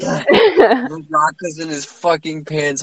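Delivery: laughing repeat. Real, not acted. Still laughing at the joke.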